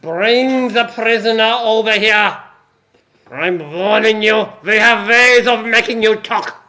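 loud menacing German officer; cool foreign spy menace. Acted. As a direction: threaten slowly in German accent. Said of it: "the accent as well".

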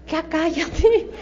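drawn-out, exasperated mock plea. Coach silently begging a talkative recruiter to stop, exasperated. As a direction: drag it out, slowly, wishing they'd stop.

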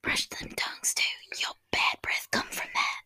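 sly whispered advice; whispering. Pretend. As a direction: whisper it close to the mic. Whispered joke.

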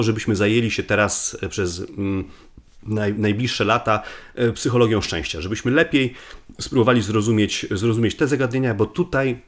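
ordinary talk

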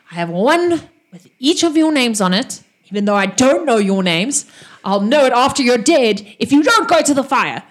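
a hint of a snarling knife-wielder; menacing halfling. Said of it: "And he says".

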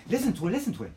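high, excited mimicry of her; eager urging. Woman showing off her cooking, eager and high.

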